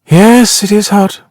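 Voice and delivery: high, drawn-out sighing agreement. A narrator's reading. Woman complaining of the heat to her husband, sighing. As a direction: agree with a sigh, pitched high. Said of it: "sighing deeply".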